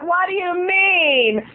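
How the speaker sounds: high, slow mock puzzled voice; imitating Laura. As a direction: drag out the question high. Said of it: "she's like".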